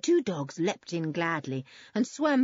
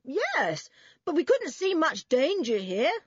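high, carrying reply; calling back; 9 semitones higher, 4 dB louder than usual. A narrator's reading. Boy answering the man from the water, calling back. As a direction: call the answer back over a distance. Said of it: "called back Julian".

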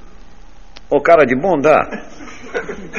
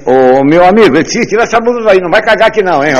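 much higher, louder shout up from the manhole; calling out; 12 semitones higher, 8 dB louder than usual. Retold or imitated. Utility worker shouting up at the man above. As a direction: yell it up at the stranger.

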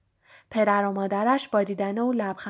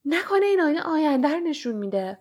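eager, higher and brighter with wonder; excited; 7 semitones higher, 3 dB louder than usual. A narrator's reading. Ron staring at his reflection, excitedly guessing at Harry. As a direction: gasp out a thrilling guess. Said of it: "رون با حالتی هیجان زده".

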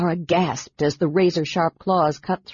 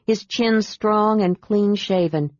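plain reading voice

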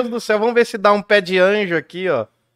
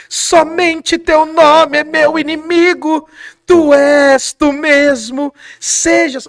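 dramatic Juliet; 9 semitones higher, much slower than usual. Acted. Juliet calling out to absent Romeo, high and drawn out. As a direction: swoon it out like a stage Juliet longing for Romeo. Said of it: "Aí a Julieta começa assim".

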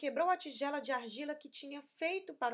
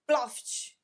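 sound effect word; 2 semitones higher, 7 dB louder than usual. A narrator's reading.

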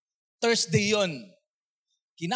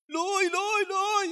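much higher, urgent calling of Loy; sister calling in high pitch; 13 semitones higher, much slower than usual. Retold or imitated. Sister on the phone at dawn, calling high and urgent. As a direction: call his name high and pleading. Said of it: "Ganun yung tono niya".

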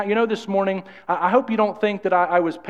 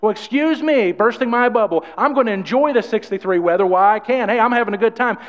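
higher, louder, sarcastically offended; mock indignant; 4 semitones higher, 3 dB louder than usual. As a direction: brush off the naysayer with a huffy joke. Sarcastic retort to the naysayer.

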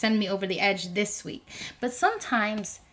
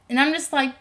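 ordinary delivery

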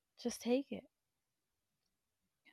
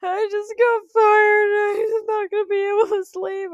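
much higher and louder, wailing outburst; losing it; 13 semitones higher, 14 dB louder than usual. Retold or imitated. Retelling her meltdown at the clinic lady on the phone. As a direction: blow up in a shrill meltdown. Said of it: "I just lost my shit".

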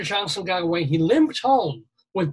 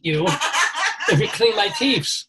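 giggling through a gator's threat; amused mock menace; laughing mock threat; 4 semitones higher, 4 dB louder than usual. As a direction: laugh while playing the gator. Alligator threatening dentist, laughing.

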